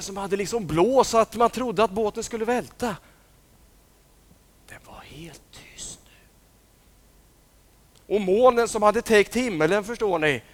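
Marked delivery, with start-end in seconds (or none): 4.7-6.1 barely voiced whisper; silent whisper. A narrator's reading. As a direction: whisper the stillness. Wind gone quiet.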